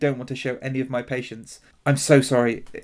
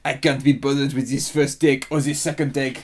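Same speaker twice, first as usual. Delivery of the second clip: put-on French accent. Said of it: "we managed to offend Eastern Europeans and the French".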